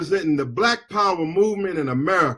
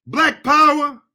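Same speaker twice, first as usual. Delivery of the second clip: rally cry; 7 semitones higher, 4 dB louder than usual. Real, not acted. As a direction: shout it up at the crowd. Rally cry in angry speech.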